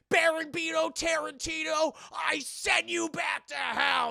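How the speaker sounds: high, loud, slow banishing cry; commanding exorcist shout. Retold or imitated.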